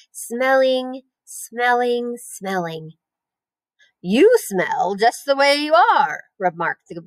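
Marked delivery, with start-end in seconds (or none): 0.1-2.9 drawn-out words, each lingered over; dreamy savouring. A narrator's reading. Pig daydreaming of sniffing along the forest floor, dreamy and drawn out. 4.0-6.2 high, drawn-out sneer; taunting. A narrator's reading. Lamb insulting the pig about how it smells, taunting.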